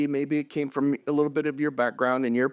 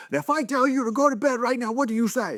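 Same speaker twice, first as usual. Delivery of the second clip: higher, firm drill-sergeant dad voice; commanding dad; 11 semitones higher, faster than usual. Retold or imitated. Dad drilling his little kids on obedience, mock stern. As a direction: bark the question like a playful drill sergeant.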